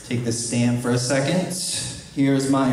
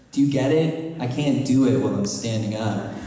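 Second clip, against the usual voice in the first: ordinary talk, nothing marked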